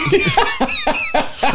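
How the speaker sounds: laughter jumping way up in pitch; high squeal of laughter. Real, not acted. Reacting to the Mitsubishi joke, high squealing laugh.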